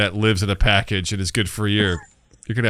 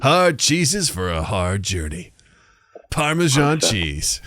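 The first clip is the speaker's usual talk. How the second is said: chipper 1950s ad; much slower than usual. Pretend. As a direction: sell it like a vintage commercial. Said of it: "the slogan for a commercial".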